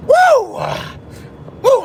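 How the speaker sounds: high, loud "woo"; high-pitched burst of excitement; excited whoop. Real, not acted. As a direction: let out a real excited whoop. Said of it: "I'm excited".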